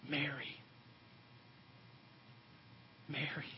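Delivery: soft, hushed calling of a name; gentle Jesus. Acted. Jesus to Mary weeping at the tomb, softly. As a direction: call the name tenderly, very quietly. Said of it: "And Jesus said to her".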